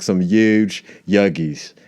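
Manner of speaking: very slow "Some huge Yuggies", huge as yooj; yuge Yuggies. Retold or imitated. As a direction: stretch each word out without the H. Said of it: "they don't say Huggies like that".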